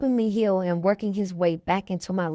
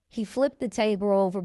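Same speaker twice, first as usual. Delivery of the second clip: usual speaking voice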